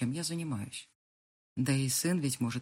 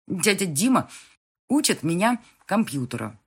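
put-on loud kid talking with mouth full; child with mouth full; 4 semitones higher, 9 dB louder than usual. A narrator's reading. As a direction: say it through a mouthful of food. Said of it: "поясняет сын с полным ртом котлеты".

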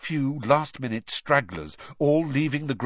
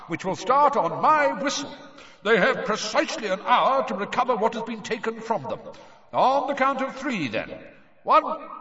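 much higher, loud magically amplified announcer; booming announcer; 12 semitones higher, 4 dB louder than usual. A narrator's reading. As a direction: boom it across the lake to the crowd, counting up. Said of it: "his voice boomed out across the dark water".

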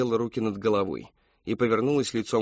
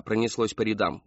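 ordinary delivery, nothing marked

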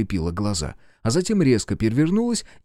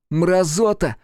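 angry insult; 8 semitones higher, 3 dB louder, slower than usual. Acted. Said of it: "не стал сдерживаться тот".

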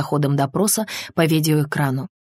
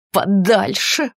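grim, drawn-out, higher voice; 4 semitones higher, 3 dB louder, much slower than usual. A narrator's reading. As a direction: stretch the word out darkly. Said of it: "мрачно произнесла Линда". (мрачно, с нажимом, растягивая)

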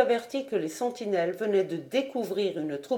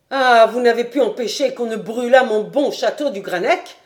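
loud, outraged question; furious cry; 3 semitones higher, 8 dB louder than usual. A narrator's reading. Lord seizes the lieutenant by the collar, furious shout. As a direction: hurl it at him while grabbing his collar. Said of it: "s'écria La Fontenelle".

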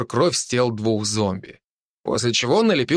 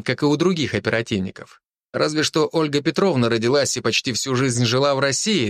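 ordinary delivery

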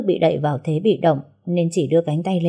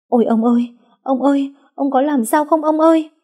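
much higher, louder crying wail; panicked wailing; 9 semitones higher, 4 dB louder than usual. Acted. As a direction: wail for the fallen husband in panic. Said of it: "hốt hoảng chạy đến khóc lóc".

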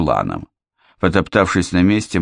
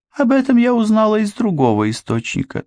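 put-on young woman voice. Anne voiced by narrator.

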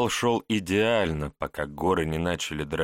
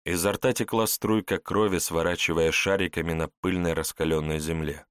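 ordinary delivery, nothing marked

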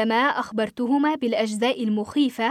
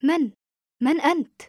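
puzzled question on the phone; tentative, querying voice; questioning mother; 3 semitones higher than usual. A narrator's reading. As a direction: ask who is calling. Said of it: "وأجابت أمي متسائلة".

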